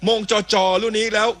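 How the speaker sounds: high-pitched live football call; excited play-by-play. Real, not acted. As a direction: pitch jumps right up for the goalmouth chance. Live goalmouth action.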